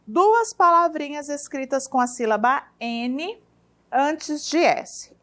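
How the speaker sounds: stretched, deliberate words. Shown as a demonstration. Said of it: "teacher dictates the rule".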